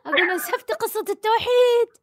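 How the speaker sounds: high, squeaky mock outrage; playful teasing. Real, not acted. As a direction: tease her in a high voice. Host teasing caller playfully.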